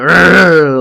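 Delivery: mock snarl of rising rage; angry Marge growl. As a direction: growl like a wife about to snap. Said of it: "Marge starts getting the".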